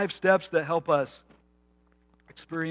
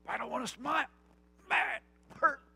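put-on pouting kid; 9 semitones higher than usual. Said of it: "when you were a little kid".

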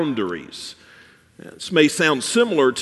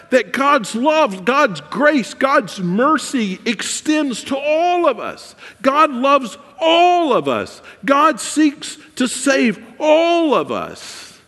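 higher and slower, building toward a shout; rising preaching climax; 9 semitones higher, slower than usual. Acted. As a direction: preach it to the rafters, growing each line.

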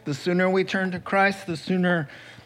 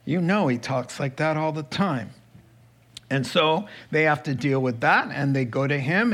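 normal talking voice

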